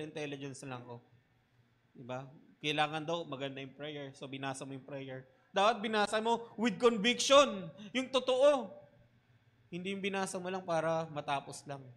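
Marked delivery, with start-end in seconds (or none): 5.5-8.7 forceful preaching. Acted. Preacher urging real conviction.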